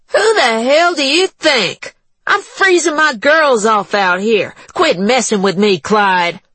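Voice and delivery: high, brassy, impatient woman; brash woman voice. A narrator's reading. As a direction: shout through the door, annoyed. Juanita at the door.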